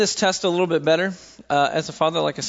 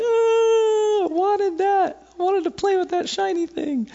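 put-on much higher, whiny toddler in a meltdown; whining toddler; slower than usual. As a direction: whimper like a two-year-old whose toy was taken. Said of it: "full meltdown".

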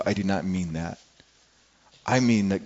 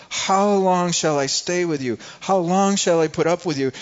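harsh rebuke; 5 semitones higher, 3 dB louder than usual. Acted. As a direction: drag out each question in weary frustration. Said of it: "they sound a little bit harsh".

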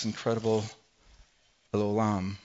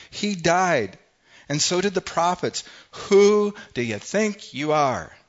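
loud, high, outraged, dragging the last question slowly; indignant exclaiming; 7 semitones higher, 6 dB louder, slower than usual. Acted. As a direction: throw the accusation back at him.

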